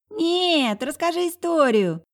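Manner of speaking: put-on high whiny little boy; whining child. A narrator's reading. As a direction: whine like a four-year-old. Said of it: "законючил четырёхлетка".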